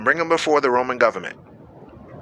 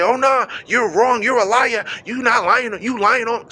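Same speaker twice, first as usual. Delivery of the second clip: mock defensive protest; 6 semitones higher than usual. Imitating someone defending himself against liars, higher and indignant. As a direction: play the flustered accused, half-mocking.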